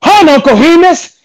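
high, taunting villain line; mock the victim before the kill; menacing quote. Friend retells a movie villain's taunt, high and menacing. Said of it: "and he goes".

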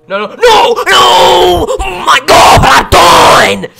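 drawn-out, full-volume wail of despair; real rage-scream at losing; anguished scream. Real, not acted. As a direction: scream it out in agony, very loud and high. Killed in game, screaming in despair.

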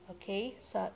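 ordinary talk

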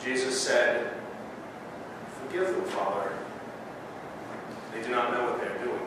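measured, solemn Gospel reading; scripture reading. Acted. Reads Gospel in service.

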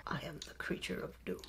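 hushed spooky whisper; creepy doom creature. Pretend. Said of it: "Act a scary one out".

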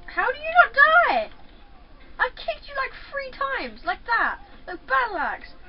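high, loud disbelieving shout; outraged high yell. Real, not acted. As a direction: yell at the unkillable enemy. Outraged at opponent surviving.

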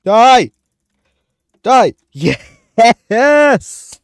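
high, loud screaming cry; battle scream. Real, not acted. Screaming at an opponent in the game, then cheering. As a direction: scream it with full force.

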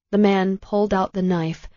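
plain reading voice

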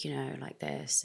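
quiet, slow and held back, showing the voice she doesn't use; deliberately muted, a toned-down way of talking; subdued demo. Shown as a demonstration. An expressive woman shows the muted voice she avoids, quiet and slow. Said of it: "that's just not the way I speak".

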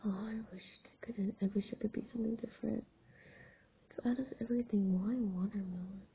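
fast grumbling whisper. Real, not acted. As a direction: mutter it under your breath. Grumbling aside about the request.

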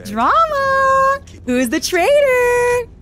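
very high, drawn-out excited squeal; gleeful drama squeal. Real, not acted. Streamer relishes the betrayal twist, high gleeful squeal. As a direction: sing out the scandal in a high squeal.

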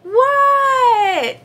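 very high, long-drawn holler; high-pitched, stretched-out kid's yell; yelling back. A narrator's reading. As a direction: yell back to a calling mother. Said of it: "Froggy yelled".

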